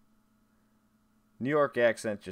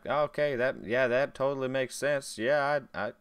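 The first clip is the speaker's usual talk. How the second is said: higher-pitched Southern US accent, imitating oneself; 5 semitones higher, 4 dB louder than usual. Streamer recalls coming home from Alabama, Southern accent. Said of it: "with a Southern accent".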